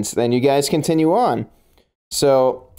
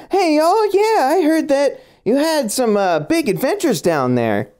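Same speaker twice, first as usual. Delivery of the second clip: put-on southern accent; 13 semitones higher than usual. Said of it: "a little bit of a southern drawl".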